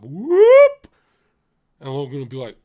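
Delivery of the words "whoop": loud swooping whoop, shooting upward; imitated whoosh of floating away; rising "whoop". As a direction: slide way up high on one whoop. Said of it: "he'd float back up".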